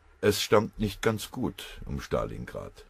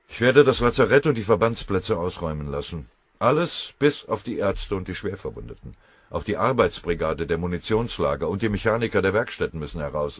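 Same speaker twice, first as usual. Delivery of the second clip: loud, higher resolute plan; determined orders; 3 semitones higher, 7 dB louder than usual. Acted. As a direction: announce the last resort firmly. Tschuikow gibt entschlossen Befehle.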